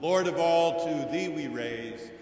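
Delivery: ordinary talk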